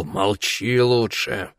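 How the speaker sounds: slow hissed threat; hissing. A narrator's reading. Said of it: "прошипел".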